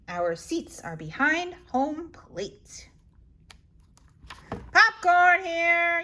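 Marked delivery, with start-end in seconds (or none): 4.7-6.0 loud, high, drawn-out vendor call; vendor's yell. Acted. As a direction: hawk popcorn to the whole stadium. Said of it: "yells a boy".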